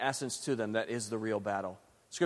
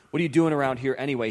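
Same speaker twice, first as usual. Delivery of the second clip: put-on fast, louder demand from an angry older brother; 2 semitones higher, 7 dB louder, faster than usual. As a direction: snap the question at your kid brother. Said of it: "he demanded".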